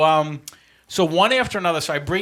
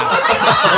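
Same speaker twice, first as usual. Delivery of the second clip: high loud laughing burst; loud burst of laughter; 5 semitones higher, 11 dB louder than usual. Real, not acted. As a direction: let out a big laugh. Laughs after doctor's scolding.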